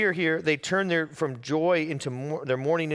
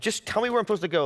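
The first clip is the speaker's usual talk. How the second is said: rushed, higher-pitched exasperation; exasperated plea; 7 semitones higher, much faster than usual. Retold or imitated. Worn-out traveler begging airline staff for directions, exasperated. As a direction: plead fast, at the end of your patience.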